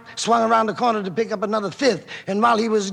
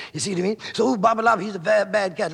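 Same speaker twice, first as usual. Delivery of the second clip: ordinary talk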